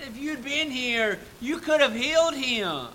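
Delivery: high, loud reproach; challenging plea. Acted. Sister challenging Jesus, loud reproachful plea. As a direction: push back at him in grief.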